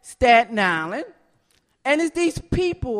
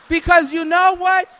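normal talking voice

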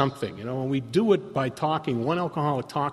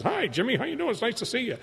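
high, sing-song mock welcome; hammed-up overfriendly greeter gushing at a newcomer; a cheery oldtimer swooping up to a scared newcomer, much higher; Don's eager greeting; 10 semitones higher, 3 dB quieter, faster than usual. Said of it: "this boob like Don comes up and goes".

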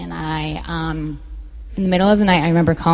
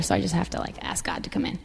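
nervous hush; 11 dB quieter, faster than usual. Real, not acted. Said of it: "I know I'm getting a little nervous here".

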